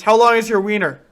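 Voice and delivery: loud, playful jab at a friend; loud mock question to a friend; teasing shout. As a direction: call it out loud like a schoolyard taunt.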